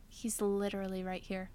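flat annoyed dad. Retold or imitated. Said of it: "He was like".

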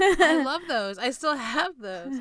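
loud, high burst of delight; excited. Real, not acted. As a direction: gush about the socks loudly. Excited about gifted socks.

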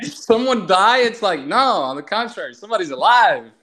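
high, swooping mock alarm and correction; animated mock exchange. Acting out someone asking if a person died, animated and high. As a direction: act out the mix-up in a high, lilting voice.

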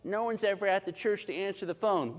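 lightly voiced church complainer; mock complaining. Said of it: "People would complain".

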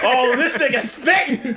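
loud, high, drawn-out hype cry; hammed-up amazed holler; hyped amazement. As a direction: stretch it out, laughing with admiration. Acting out amazed reaction to speech.

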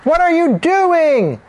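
high, drawn-out cry of alarm; mother yelling at the scene; shocked mothers' shout. A narrator's reading. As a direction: shout it in outraged disbelief. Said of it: "The mothers shouted".

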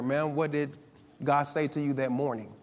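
low, soft, careful asking; retold gentle question. Minister quietly asking the robbed woman, low and soft.

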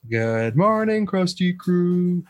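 sing-song group greeting; cheery greeting. Shown as a demonstration. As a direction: greet the crew brightly. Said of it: "my favorite non-gender, non-conforming greeting".

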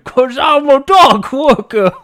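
loud Cockney dock worker. Pretend. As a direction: answer like a rough dock worker. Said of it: "why is he talking like a dock worker".

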